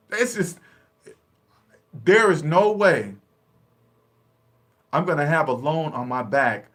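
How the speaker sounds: normal talking voice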